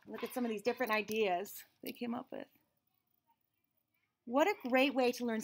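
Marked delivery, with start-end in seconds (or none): none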